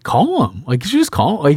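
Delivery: casual. Retold or imitated. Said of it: "Like, just kind of casual".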